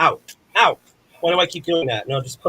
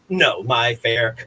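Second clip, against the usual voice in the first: ordinary delivery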